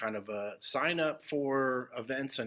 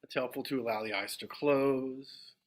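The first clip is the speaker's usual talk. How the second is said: unhurried meditation guidance with long silences; guided meditation. Acted. Teacher guiding the group into meditation, spacious and measured. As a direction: settle listeners gently, phrase by phrase, leaving long pauses.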